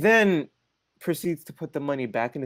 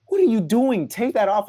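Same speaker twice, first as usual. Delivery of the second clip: raised, higher mock-scolding voice; imitated scolding; 8 semitones higher, 4 dB louder than usual. Mom orders dad to remove ski mask, mock scolding. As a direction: act out an exasperated telling-off.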